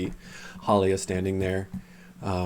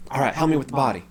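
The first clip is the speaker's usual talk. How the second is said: urgent order; 6 semitones higher, 4 dB louder, much faster than usual. Acted. Halia urgently orders help after stabbing.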